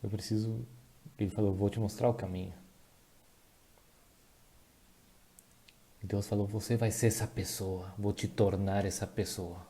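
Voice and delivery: soft, subdued, holding back real emotion; moved. Real, not acted. Preacher retelling God's promise to him, deeply moved. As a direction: say it softly, pausing to keep composure. Still moved after apologising.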